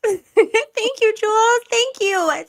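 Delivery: high, loud burst of thanks; excited cheer. Real, not acted. Cheering gifted memberships to their team.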